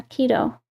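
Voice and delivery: "keto" said KEE-toh, long E. Real, not acted. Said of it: "I never know if I'm saying it right".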